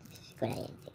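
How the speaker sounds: breathy whispered question, voice dropped out; hushed mystery whisper. Acted. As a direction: lean in and whisper it like a secret. Whispered question, repeated plainly after.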